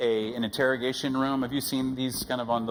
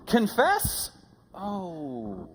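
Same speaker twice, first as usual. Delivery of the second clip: mock alarm; 6 semitones higher, much slower than usual. Reaction to being told to confess.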